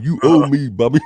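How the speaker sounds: a lighter second try at the wronged friend; Wendy impression. Host retrying his Wendy impression on Bobby. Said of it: "I'm trying to do my Wendy impression".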